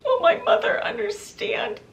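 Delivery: high, strained tearful question. Retold or imitated. Meg asks Katrina about her mother, crying. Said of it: "Meg starts crying".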